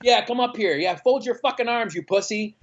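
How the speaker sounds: put-on drunk heckling. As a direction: goad the crowd like a drunk punk. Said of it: "gets on stage and it's like".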